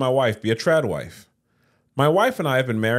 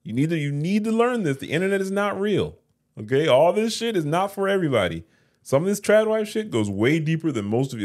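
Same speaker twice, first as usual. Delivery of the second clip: much higher, lecturing the audience; raised-voice rant; 9 semitones higher than usual. Real, not acted. Host warning viewers the internet isn't real, in a high raised rant.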